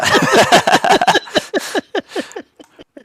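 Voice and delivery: big squealing laugh; high loud laugh. Real, not acted. Laugh after joke about family.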